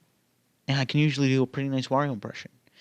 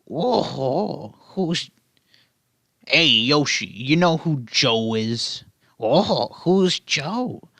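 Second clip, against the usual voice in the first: lightly voiced cartoon Luigi and Yoshi banter; a hint of the old cartoon voices, slow at the end; Super Show Luigi and Yoshi; 2 semitones higher, much slower than usual. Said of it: "I'll do the Super Show".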